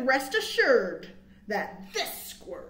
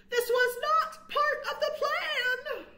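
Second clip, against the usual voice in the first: high, slow distressed cry; laughing mock panic; panicked wail; 13 semitones higher than usual. A narrator's reading. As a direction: wail it in dismay. Squirrel panics, kit falls.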